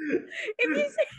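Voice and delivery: high, helpless laughing squeak. Real, not acted. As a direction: try to explain through real laughter. Cracking up explaining the prank.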